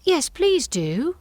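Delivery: high, slow, pleading Alice. A narrator's reading. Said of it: "pleaded Alice".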